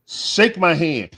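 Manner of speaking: drawn out like a signature line; catchphrase. Pretend. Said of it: "I want my young guy".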